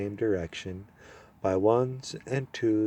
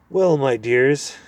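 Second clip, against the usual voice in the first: put-on kindly old dwarf; 3 semitones higher, 6 dB louder, slower than usual. Old dwarf welcoming the children. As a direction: greet them warmly like an old lord.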